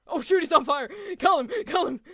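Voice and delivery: high, loud, urgent cries; alarmed calling out. Real, not acted. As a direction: yell for someone to come and help. Calling out to character on fire.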